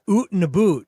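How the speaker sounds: exaggerated Canadian "oot and a boot"; mock Canadian accent. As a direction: tease a Canadian accent with long oo vowels. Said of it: "Brett has an accent".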